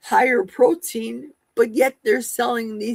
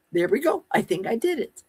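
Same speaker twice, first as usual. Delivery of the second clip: ordinary delivery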